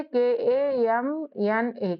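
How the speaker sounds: letters spelled out one by one, slowly; slow spelling. Shown as a demonstration. Teacher spelling a name slowly for students. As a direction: dictate each letter slowly for the class. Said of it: "तो कैसे लिखोगे".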